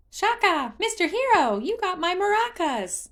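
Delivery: high, loud delighted shout; a child greeting a returning hero; joyful cry. Acted. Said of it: "he cried".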